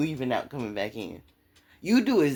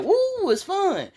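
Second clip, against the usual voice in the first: high, drawn-out thrilled whoop; happy screaming; 4 dB louder, slower than usual. Acted. Rider on a roller coaster yelling for fun, happy scream. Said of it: "yelling and screaming like".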